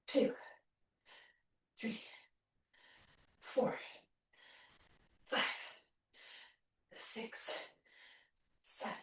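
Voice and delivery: barely voiced, very soft rep count; counting under exertion. Real, not acted. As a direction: puff out each number with the effort. Counting reps while working hard.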